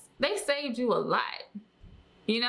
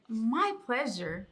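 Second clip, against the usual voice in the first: slow, sugary customer-service voice; imitating overly polite staff; much slower than usual. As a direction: mimic a smiling robot-like worker, drawn out. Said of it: "and they're just like".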